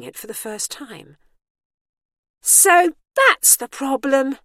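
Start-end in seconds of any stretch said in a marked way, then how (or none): 2.4-4.4 high, slow, loud realisation; relieved. A narrator's reading. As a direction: let the relief draw the words out. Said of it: "she said in relief".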